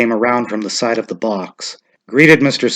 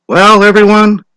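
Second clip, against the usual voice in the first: louder, higher call; announcing to the crowd; 10 semitones higher, 9 dB louder than usual. A narrator's reading. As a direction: call the men to open their slips. Official addressing crowd.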